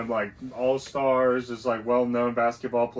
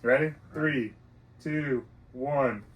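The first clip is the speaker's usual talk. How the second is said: ordinary talk, nothing marked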